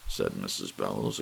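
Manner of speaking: ordinary delivery, nothing marked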